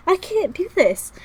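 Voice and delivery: very high, loud cry; high-pitched, loud, helpless protest; overwhelmed wail. Real, not acted. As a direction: wail it out, at breaking point. Same words, voice leaps up.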